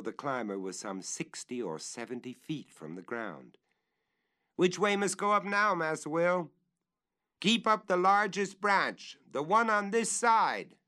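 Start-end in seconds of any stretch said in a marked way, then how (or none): 4.6-6.5 much higher, louder call from the branches. Acted. 7.4-10.8 much higher, louder directions; calling up. Acted.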